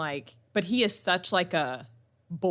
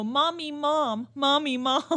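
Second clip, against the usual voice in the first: put-on higher, slow sing-song kid voice; toddler calling; 7 semitones higher, slower than usual. Son mixing mommy and mom, higher sing-song. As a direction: draw out the calls like a toddler. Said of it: "he'll go".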